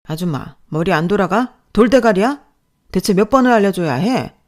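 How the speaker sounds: loud contemptuous shouting; insulting yell. Acted. Boss insults her intelligence, loud and contemptuous. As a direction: belittle her at full volume.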